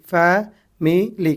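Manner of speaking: Family very slowly in three separate beats; syllable by syllable. Shown as a demonstration. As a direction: split family as fa, mi, li with gaps. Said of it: "कितने syllables".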